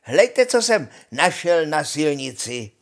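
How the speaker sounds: high stretched holler, then loud eager call; calling after someone. A narrator's reading. Honza shows brothers his find, calling loudly. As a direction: shout ahead to make them look. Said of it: "volal za nimi hloupý Honza".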